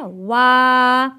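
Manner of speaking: "वा" said with rounded lips. Shown as a demonstration. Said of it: "होंठों को गोल करके". (होंठ गोल करके "वा")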